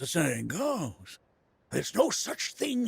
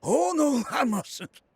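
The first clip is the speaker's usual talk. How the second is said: flustered refusal; 10 semitones higher, 5 dB louder, slower than usual. Acted. Refuses tempting offer of ride.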